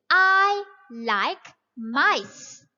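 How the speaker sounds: slow, clear, every word spelled out; slow model reading. Shown as a demonstration. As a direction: read it slowly for young learners. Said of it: "You will say".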